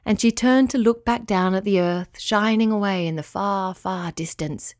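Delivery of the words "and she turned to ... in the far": usual speaking voice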